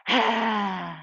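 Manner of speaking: forceful open-mouthed breath out; lion's breath exhale. Shown as a demonstration. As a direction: exhale noisily with tongue stuck out. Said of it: "lion's breath".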